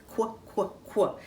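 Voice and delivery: "quack" cut down to a short "kwuh"; quacking duck sound. Shown as a demonstration. Teacher modelling the duck sound for children, "quack" as short "kwuh". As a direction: say "quack" as just its opening kw sound. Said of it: "So the quacking duck sound is".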